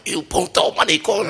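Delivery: mock Spanish insult. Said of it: "Greg Jackson's like".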